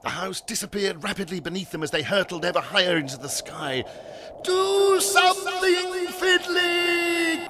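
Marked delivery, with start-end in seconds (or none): 4.4-7.5 high, loud, drawn-out cry for help; desperate cry. A narrator's reading. Algernon begs Fiddly to act in the sky, desperate cry. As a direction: call out urgently, stretching the words. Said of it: "cried Algernon".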